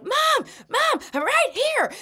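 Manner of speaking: mock yell of a child trying to be heard; yelling for mom. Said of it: "I have been yelling your name".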